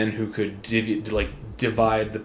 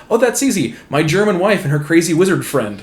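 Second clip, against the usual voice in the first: higher, airy tsar, carelessly cheerful; teacher voicing the tsar in a cartoon, higher and breezy; 5 semitones higher, 5 dB louder than usual. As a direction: toss it off like an oblivious tsar. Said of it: "Tsar Nicholas goes".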